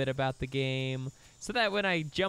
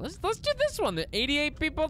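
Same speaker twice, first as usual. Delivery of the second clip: excited; 11 semitones higher than usual. Real, not acted. Starting the game, hyped up.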